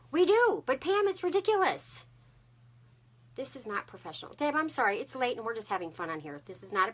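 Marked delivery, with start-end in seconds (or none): 0.1-2.0 high, loud burst of mock protest; exclaimed high and loud; mock indignant. Real, not acted. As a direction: play up the outrage for laughs. Jokingly protesting to viewers.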